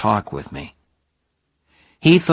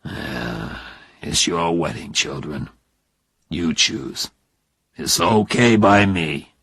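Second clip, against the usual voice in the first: lower, hiding disappointment, a touch of brave resignation; bravely; 4 semitones lower than usual. Acted. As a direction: swallow the hurt and accept it. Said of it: "But he spoke bravely".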